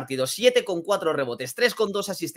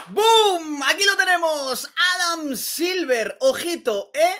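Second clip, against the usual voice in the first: excited boom; 8 semitones higher, slower than usual. Real, not acted. Announcing All-Star news.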